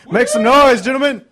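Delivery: loud, high-pitched rallying call. Real, not acted. Host calls on the bands in the studio, calling out. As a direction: rouse the room to cheer.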